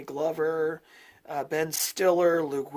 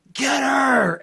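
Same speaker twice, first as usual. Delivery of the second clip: higher, louder yell from the movie; retold movie yell, higher and louder; 8 semitones higher, 9 dB louder than usual. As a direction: shout it like the film characters. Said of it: "where they yell".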